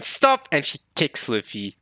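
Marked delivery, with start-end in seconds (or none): 0.0-0.5 high, sudden yelled stop; acted shout. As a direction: yell it to halt someone. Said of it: "She's going".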